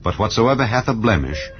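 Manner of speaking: ordinary delivery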